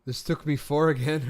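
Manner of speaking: normal talking voice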